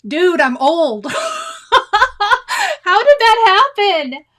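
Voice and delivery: real laugh through the words, "How did that happen?" squeaked high. Real, not acted. Laughing at her own age.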